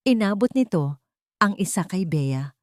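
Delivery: usual speaking voice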